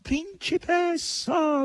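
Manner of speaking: high, drawn-out whimper; whimpering pig call; whining boar. A narrator's reading. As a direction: whine it like a pitiful hog. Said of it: "con voce piagnucolosa".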